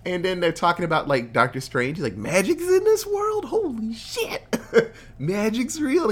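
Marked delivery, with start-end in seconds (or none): 2.2-6.1 much higher, slowing down in awe; amazed and excited. Retold or imitated. Retelling Peter three discovering magic, high amazed voice. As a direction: gush like a wide-eyed fan.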